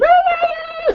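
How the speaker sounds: very high-pitched imitated battle yell; Xena war cry. Podcaster mimicking Xena jumping around, shrill war cry. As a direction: let out a piercing mock warrior cry. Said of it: "jumping around going".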